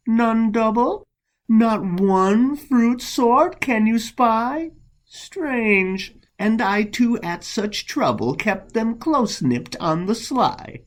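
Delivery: high, sly feigned surprise; mock innocence. Monk who nipped the rival's flowers asks, high mock innocence. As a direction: play innocent in a high voice, secretly gloating.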